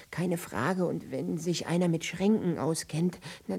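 ordinary talk

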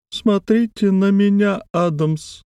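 high, slow insinuating voice; a thin eerie wheedle; thin wheedling voice. A narrator's reading.

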